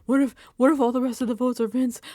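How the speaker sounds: panicked rush. Retold or imitated. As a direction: blurt it out in a stressed rush. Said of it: "I was so stressed in the moment".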